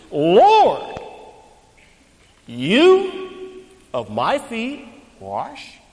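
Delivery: slow, drawn-out and emphatic, each word weighted. Retold or imitated. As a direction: press every word of "you of my feet wash" slowly. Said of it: "Very emphatic".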